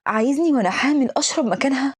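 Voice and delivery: usual speaking voice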